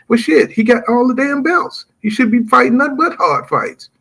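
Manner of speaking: rising heat as the point lands; fired-up rant. Real, not acted. Heated rant about Canelo's belts.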